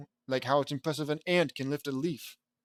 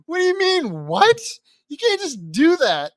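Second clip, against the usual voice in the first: high, loud squawk of protest; outraged disbelief; 12 semitones higher, 9 dB louder than usual. Real, not acted. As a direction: protest as if personally cheated. Reader protests the story's twist.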